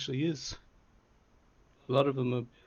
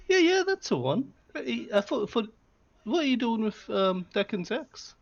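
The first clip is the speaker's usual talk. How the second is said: much higher and louder, surprised shopkeeper; Minerva's voice; 9 semitones higher, 4 dB louder than usual. Acted. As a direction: perk up high as the eager shopkeeper Minerva. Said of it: "Looks at the axe and goes".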